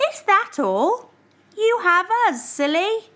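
high, bright reassuring voice; a light loving tease; fond teasing. Acted. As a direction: cheer up a sad friend. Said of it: "cried Hedgehog, Mouse, Squirrel".

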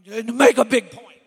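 sudden booming burst into the mic; imitating pastor's emphasis. Preacher mimicking his anointed pastor, sudden loud emphasis. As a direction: lean into the mic and blast it out. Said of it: "lean over in his mic".

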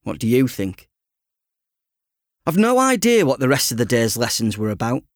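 usual speaking voice

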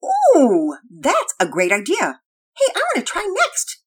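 put-on loud, high eager girl; thrilled "ooh", then high, loud eagerness; excited echo. A narrator's reading. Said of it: "Penelope echoed".

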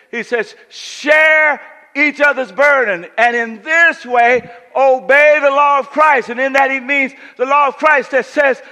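loud, high, driving pulpit voice; full-force preaching. Acted. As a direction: preach it hard to the whole room.

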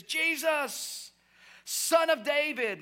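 high, drawn-out desperate cry; acted scream. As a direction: cry out for attention, short of a full scream. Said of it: "this is what he's screaming".

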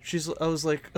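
normal talking voice

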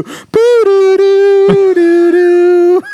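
mock sad tune. Mock sad music for lonely scene.

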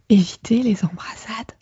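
breathy whispered announcement. Shown as a demonstration. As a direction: whisper it like a secret. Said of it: "whisper repeat".

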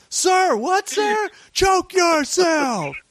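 very high, strained barked orders; mock drill sergeant. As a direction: bark at a recruit, dragging out the last command. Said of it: "like in Full Metal Jacket".

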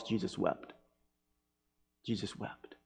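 soft, low, lingering on wept; hushed grief. Acted. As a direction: let the sorrow sit in the words.

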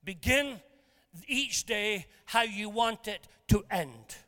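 slow, each word laid down deliberately; slow emphatic. Shown as a demonstration. Preacher drives home his key line to listeners, slow and deliberate. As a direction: spell out the key line slowly. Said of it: "That's the point".

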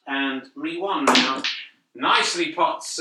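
ordinary talk

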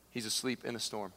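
normal talking voice

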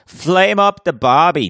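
high, loud, slow hammed-up Australian shout; mock Australian accent. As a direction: bellow it like a cartoon Aussie at a barbecue. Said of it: "Australian accents".

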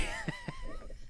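a sudden high-pitched soft laugh. Real, not acted. Laughing at the dumb kid joke, high giggle. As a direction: burst into a squeaky giggle.

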